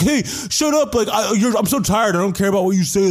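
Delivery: hammed-up, high-pitched protest; high mock-indignant voice; mock whiny retort. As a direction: imitate a man whining back at a heckler. Jax snapping back in retold spat.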